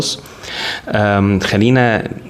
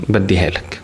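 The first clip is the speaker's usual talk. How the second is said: calm and unhurried, easing listeners into relaxation; soothing yoga teacher. Real, not acted. Leads a guided body scan.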